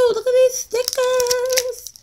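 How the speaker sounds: high, slow cooing voice. Real, not acted. Crafter cooing at cute stickers, high and slow.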